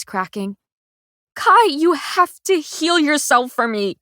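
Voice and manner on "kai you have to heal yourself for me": high loud begging; anguished insistence; desperate plea. A narrator's reading. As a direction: plead through tears. Begging him to heal.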